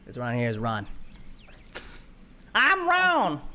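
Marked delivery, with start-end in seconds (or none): 2.5-3.4 very high, loud goofy shout; Ron. Acted. Ron cheerfully shouts his own name. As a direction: yell it like a dim cheerful giant. GM voices Ron introducing himself.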